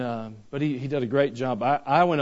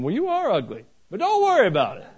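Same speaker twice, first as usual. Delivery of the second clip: higher, hammed-up blunt father; mock father, pitched up; mock dad voice; 9 semitones higher, 6 dB louder than usual. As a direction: play the careless dad for the laugh. Dad's blunt reply joke.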